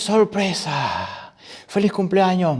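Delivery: high, drawn-out party cheer; surprise party shout. Acted. Guests greet the birthday girl as lights come on, joyful surprise shout. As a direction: cheer it out as a whole crowd.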